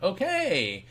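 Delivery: high, drawn-out okay; a long, lifted okay as it sinks in; intrigued realisation. Retold or imitated. Reacting to chills in retold story.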